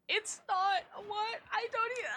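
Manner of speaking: high, baffled protest; can't believe what chat says; incredulous. Real, not acted.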